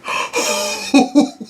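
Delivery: high, breathy gasp of shock. Acted. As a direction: gasp as if stunned by the news. Acted shock at seeing bounty.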